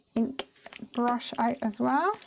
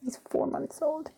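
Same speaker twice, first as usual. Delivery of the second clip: ordinary talk, nothing marked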